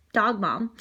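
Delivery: usual speaking voice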